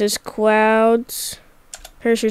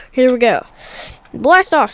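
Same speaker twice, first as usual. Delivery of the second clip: ordinary delivery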